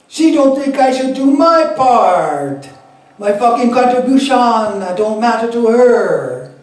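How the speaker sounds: loud, high, aggrieved Jamaican Rasta voice; a Rastafarian complaining hotly about his sister; heated Jamaican Rastafarian accent, high and loud. Acted. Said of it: "my Rastafari accent".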